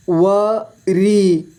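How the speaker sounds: वरी split slowly into व, री; syllable by syllable. Shown as a demonstration. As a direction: stretch and separate व and री in वरी. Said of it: "इस तरह से आपको". (वरी को तोड़कर धीरे: व, री)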